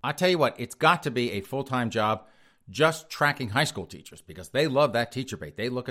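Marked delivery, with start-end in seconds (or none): none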